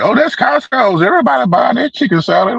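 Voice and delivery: high, fast chatty cousin. Retold or imitated. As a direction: rattle it off high and quick. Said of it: "She's like".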